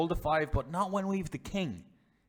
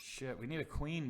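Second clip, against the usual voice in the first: normal talking voice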